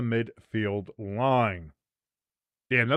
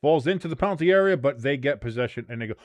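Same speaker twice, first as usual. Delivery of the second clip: normal talking voice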